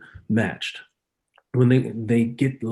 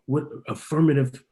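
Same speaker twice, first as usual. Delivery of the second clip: ordinary talk, nothing marked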